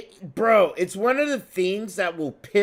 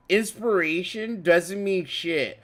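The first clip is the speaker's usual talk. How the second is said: usual speaking voice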